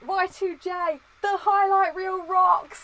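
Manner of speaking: high, slow announcer-style catchphrase; Jericho catchphrase. Retold or imitated. Fan reciting Jericho's catchphrase high and slow. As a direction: stretch it out like a ring entrance.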